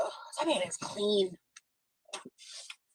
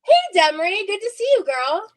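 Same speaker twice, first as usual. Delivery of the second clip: loud excited greeting; 10 semitones higher, 8 dB louder than usual. Real, not acted. Greeting guest loudly.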